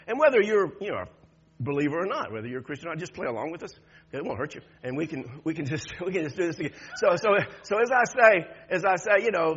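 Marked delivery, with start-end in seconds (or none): none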